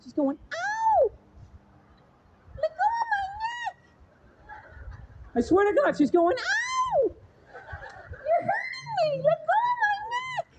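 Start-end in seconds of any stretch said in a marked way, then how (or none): 0.5-4.6 high falsetto wail, mock distressed woman; lady's voice. Lady crying out at the back of the train, high mock wail. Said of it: "I hear this lady's voice at the back of the train". 6.4-10.5 drawn-out falsetto wail, imitating a hurt woman; lady's voice. Lady at the back of the train pleading with her attacker, slow high mock wail. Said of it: "I hear this lady's voice".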